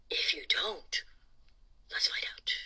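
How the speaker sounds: hushed spooky whisper. Shown as a demonstration. Host teasing the kids with a suspense, in a whisper. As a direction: whisper it like a secret. Spooky contrast to previous question.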